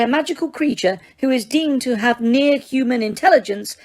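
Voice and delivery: high, prim official tone on near-human intelligence; officious Umbridge. A narrator's reading. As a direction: recite it like a bureaucrat. Umbridge reciting the law.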